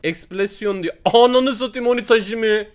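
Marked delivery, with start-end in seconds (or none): 1.1-2.7 high, loud outburst at the result; real gamer outburst; excited shout. Real, not acted. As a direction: yell it out in shock. Big win seen on case battle.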